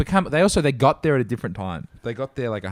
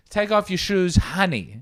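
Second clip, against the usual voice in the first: airport staff lady; 9 semitones higher, slower than usual. Retold or imitated.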